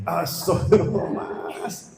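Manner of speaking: slow, laughing astagfirullah; amused mock shock; laughing exclamation. Real, not acted. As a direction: laugh it off in disbelief. Preacher reacts with shocked laugh.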